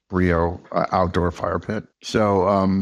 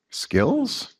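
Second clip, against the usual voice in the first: "skills" lifted up high at the end like a question; upward inflection; 10 semitones higher than usual. Shown as a demonstration. Teaching a mirror to the host, "skills" with upward inflection. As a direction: rise on "skills" so it sounds asked, not told. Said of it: "upward inflection".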